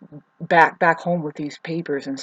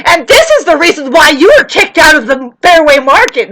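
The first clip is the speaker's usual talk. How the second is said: much higher and louder accusing quote; retold accusation; 9 semitones higher, 15 dB louder than usual. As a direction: throw the insult back at her. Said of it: "she's like".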